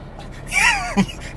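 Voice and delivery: high-pitched giggle; real laugh. Real, not acted. Owner amused by the gearbox praise, laughing.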